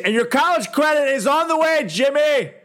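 high, bright fake promise; mock reassuring. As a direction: sell the lie cheerfully.

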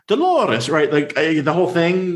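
high, hammed-up Super Mario Italian accent; a clumsy put-on Italian accent, Super Mario style; botched McGonagall turned Italian. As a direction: try a Scottish teacher and slide into Super Mario Italian. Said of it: "a Super Mario Italian".

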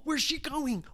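"where's" asked high and alarmed; worried. Acted. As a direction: start high on "where's", then fall. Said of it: "Ryan said".

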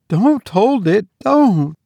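high, pleading mother's voice; frightened plea. Acted. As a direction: beg him to let it go. Frightened mother begs husband.

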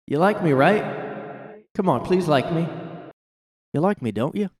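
high, wheedling plea for approval; imitating an insecure, eager-to-please comic; needy mock pleading. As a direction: beg to be liked, hammed up. Mimics comedian begging to be liked.

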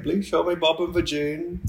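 high imitation Indian accent; mock Indian accent. Imitating silly online messages for a co-host, mock Indian accent. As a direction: lift it into a put-on Indian accent. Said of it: "the Indians are just being silly".

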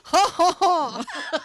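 high, loud put-on "ha ha ha"; mock laugh. Host clowning about trying to be French, mock laugh.

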